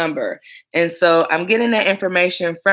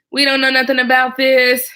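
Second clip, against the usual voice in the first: mock innocent; 6 semitones higher, 5 dB louder than usual. Playful denial about baby music.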